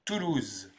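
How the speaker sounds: TOULOUSE said alone with falling pitch; TOULOUSE as an isolated French word; pronunciation demo. Shown as a demonstration. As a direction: model TOULOUSE for learners. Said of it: "Et on prononce donc".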